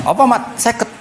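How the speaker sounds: high, incredulous objection; protesting Musa. Acted. Musa objects to the fifty prayers, high protest. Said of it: "Bareng tok Nabi Musa bantah".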